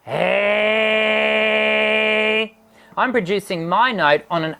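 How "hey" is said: gritty raspy sustained hey; distorted voice demo. Shown as a demonstration. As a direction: sing it with heavy grit. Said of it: "Have a listen".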